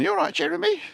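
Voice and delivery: very high put-on voice; squeaky-high imitation of home talk; imitated home voice. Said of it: "then I meet 'em at home".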